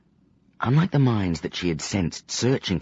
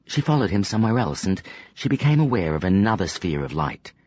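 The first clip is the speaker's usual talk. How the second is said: ordinary delivery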